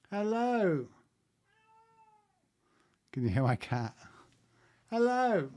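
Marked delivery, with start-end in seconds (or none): 0.1-0.9 high, falling singled-out hello; calling to a cat. Real, not acted. Streamer greets her cat mid-broadcast, high-pitched call. 4.9-5.5 high, louder falling hello; calling to a cat. Real, not acted. Streamer calls her cat for viewers, high loud hello.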